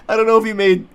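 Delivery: ordinary talk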